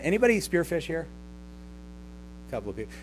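ordinary talk, nothing marked

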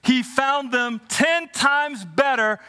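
high, slow, swelling scripture read; preaching scripture. Acted. Preacher reading Daniel to students, building and slow.

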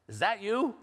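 high, loud, rising disbelief; imitated shocked question. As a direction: ask it high and alarmed, imitating a shocked relative. Shaken sister-in-law on phone, retold.

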